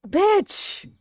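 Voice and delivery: sudden high, loud yell; outburst. Real, not acted. Reacting to the villain, loud high exclamation. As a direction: blurt it out in shocked delight.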